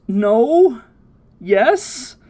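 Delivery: high, wavering, unsure of every answer; indecisive high girl voice. Retold or imitated. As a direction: squeak out each guess, unsure. Bulma unsure whether to give up.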